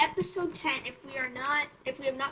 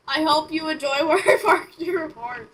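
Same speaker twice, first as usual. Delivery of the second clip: gloating; 3 semitones higher, 11 dB louder than usual. Pretend. Gloating over parked horse prank.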